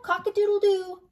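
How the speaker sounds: high, loud crowing; hammed-up rooster call. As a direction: crow it out like a rooster. Animals scare off the robbers.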